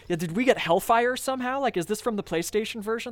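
high, puzzled disbelief; baffled disbelief. Retold or imitated. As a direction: ask it like you can't believe your eyes.

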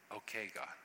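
hushed, accepting reply; quiet acceptance. Acted. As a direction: say it quietly, giving in to God. Acting out a submissive response.

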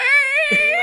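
a high, loud squealing laugh; shrieking laugh. Real, not acted. Telling friends how the wiper scared him, shrieking laugh.

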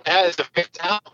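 muffled underwater phone line. Real, not acted. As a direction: sounds like talking underwater. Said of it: "sounds like you're, like, underwater".

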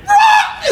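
very high, loud yelp "Rah!"; mock yelp of a dog jolted; imitated dog yelp. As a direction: yelp like a shocked dog leaping. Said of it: "and he's like".

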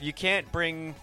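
ordinary delivery

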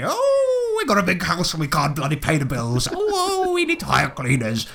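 high falsetto wailed "Oh"s; mock nagging wife. Husband mimicking his nagging wife, mock complaining. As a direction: imitate a whining wife, swooping up high. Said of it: "the wife who was nagging me".

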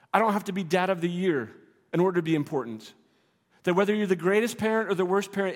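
ordinary talk, nothing marked

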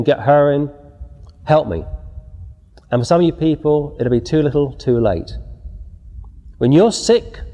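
ordinary talk, nothing marked

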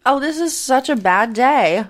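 ordinary delivery

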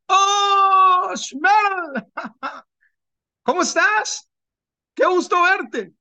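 high, bright mock-friendly hail with a laugh; high-pitched fake-friendly welcome; gleeful tempter greeting. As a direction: greet him like a sly old friend.

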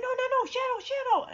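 high, rapid-fire dismay; acted high-pitched panic; alarmed pleading. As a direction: plead as a friend slips away. Alarm as Shadow slides back.

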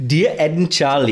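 shouted, badly faked Geordie accent; loud hammy Geordie letter reader. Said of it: "should I do it in a Geordie accent?".

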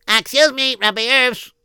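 high, nagging little voice; interrupting listener. Acted. Listener interrupting the rabbi's story, high nagging voice. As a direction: cut in politely but pushy.

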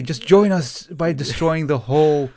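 hammy call to the cause; mock cult recruiter. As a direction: urge them to join like a playful zealot.

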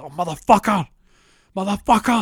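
high, loud cursing; hammed-up fury at being betrayed; enraged outburst. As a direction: yell it out, drawing out the curse. Sees girlfriend's Instagram, rages.

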